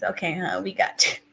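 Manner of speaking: normal talking voice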